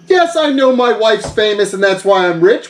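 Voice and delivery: ordinary talk, nothing marked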